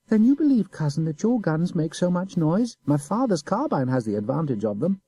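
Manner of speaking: put-on high, innocent boy's voice; simple child. A narrator's reading. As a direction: play the guileless little boy. Boy taunts.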